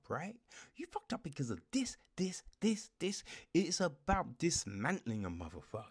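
ordinary talk, nothing marked

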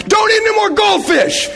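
high, loud mock yell at a child; hammed-up scolding shout from across the house; imitating a frazzled mom yelling; wife yelling. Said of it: "your wife screaming at your oldest child going".

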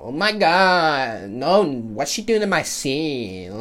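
mock a snotty teen girl, drawn out; ratchet girl impression.

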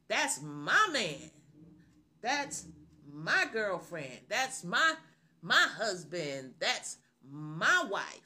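loud, drawn-out proud claim; confident bragging. Acted. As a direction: show off your partner, stretching the words boldly. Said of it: "We say those things".

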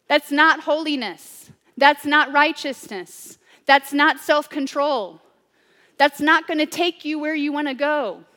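stern rebuke. Retold or imitated. Blunt rebuke quoted.

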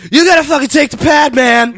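very loud, high impression of a ranting wild man; hammed-up screamed advice; imitated screaming. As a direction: yell it like you're scaring a whole restaurant. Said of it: "that's how he talks".

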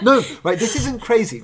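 laughing as she reassures. Real, not acted. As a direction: laugh through the words. Laughing reassurance after guess.

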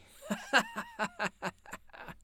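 high, loud group-style laughter; lusty roar of laughter. Acted. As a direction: roar with laughter like the whole party. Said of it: "roared out lustily".

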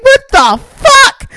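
very high, drawn-out exasperated squeal; mad disbelief. Retold or imitated. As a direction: squeal it, outraged and baffled. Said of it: "I'm mad".

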